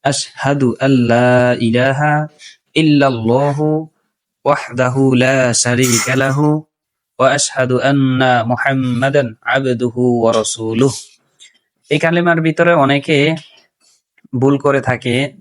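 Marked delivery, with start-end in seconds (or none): 0.0-11.0 slow, measured Arabic recitation. Shown as a demonstration. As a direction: recite the shahada carefully. Recites kalima shahadat. (ধীরে কালিমা শাহাদাত তিলাওয়াত)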